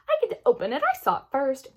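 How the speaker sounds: put-on high, loud childish claim; little sister claiming the box, high and loud; insistent little girl. A narrator's reading. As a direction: insist like a kid who saw it first.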